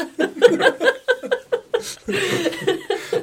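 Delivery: long rising laugh; high laugh that climbs. Real, not acted. Laughs at being told off.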